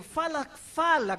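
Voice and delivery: usual speaking voice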